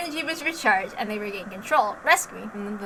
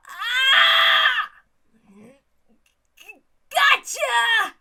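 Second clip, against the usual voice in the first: effort shout; 10 semitones higher, 6 dB louder, much slower than usual. Acted. Punches crossing in free fall.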